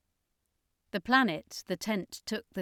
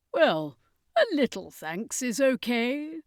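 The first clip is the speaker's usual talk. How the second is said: circus master; 5 semitones higher, 6 dB louder, slower than usual. A narrator's reading.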